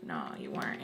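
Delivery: breathy hushed admission; whispered reply from him. Retold or imitated. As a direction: whisper it like a guilty confession. Voicing his quiet admission.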